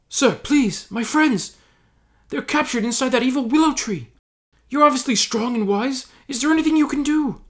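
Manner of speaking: high, breathless hobbit plea; high pleading voice. Acted.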